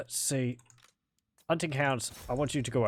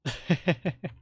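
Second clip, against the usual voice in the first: quick, higher-pitched chuckle; amused giggle; 4 semitones higher than usual. Real, not acted. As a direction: giggle in a rapid burst. Amused by silly game units.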